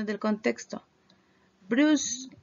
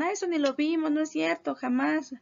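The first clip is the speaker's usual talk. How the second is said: higher, playful imitation of students denying; mock student denial; 7 semitones higher than usual. Teacher mimicking students who deny the lesson. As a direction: imitate a student insisting they never saw it. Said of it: "Para que no digan".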